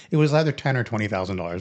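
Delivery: usual speaking voice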